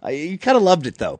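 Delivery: ordinary talk, nothing marked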